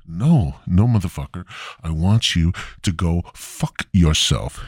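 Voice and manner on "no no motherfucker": ordinary delivery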